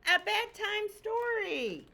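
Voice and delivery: high, slow, pleading chicken; high, drawn-out plea for a story; eager plea. Acted. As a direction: stretch it out like a begging child.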